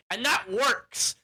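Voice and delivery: usual speaking voice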